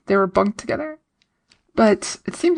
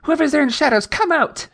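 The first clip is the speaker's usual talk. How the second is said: retold yell; 7 semitones higher than usual. Recapping Tilly's challenge to a hidden figure, mild mock yell. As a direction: call out as if challenging someone hiding. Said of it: "Tilly yells out".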